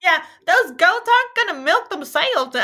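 hammed-up country farmer; old farmer. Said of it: "when I talk like a farmer".

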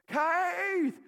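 high piercing Texas yell; high shout of the kid's name from far off; Texas mom yell. Retold or imitated. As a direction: mom hollering her son home. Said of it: "she has that Texas voice".

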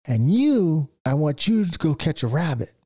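single out the dopey youngest chick; mother vulture handing the littlest one his job; mama vulture. Retold or imitated. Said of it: "she goes".

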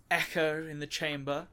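usual speaking voice

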